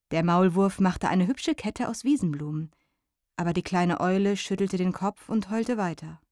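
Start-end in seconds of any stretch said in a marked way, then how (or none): none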